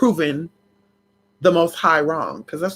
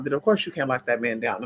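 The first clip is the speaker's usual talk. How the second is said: ordinary talk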